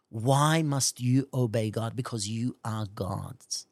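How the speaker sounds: ordinary talk, nothing marked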